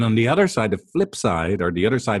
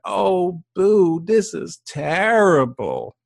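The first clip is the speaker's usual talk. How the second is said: much higher, slow mock-sad baby talk; cooing sympathy; 11 semitones higher, 4 dB louder, much slower than usual. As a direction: pout it out like a doting partner. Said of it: "goes like".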